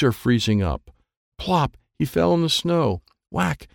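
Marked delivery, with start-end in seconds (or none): none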